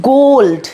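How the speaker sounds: গোল্ড with a full long ও, stressed; English word pronunciation. Shown as a demonstration. Teacher modelling gold for students, clear and emphatic. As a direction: lean on the ও in গোল্ড. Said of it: "ও এর উচ্চারণ ও এর নামে".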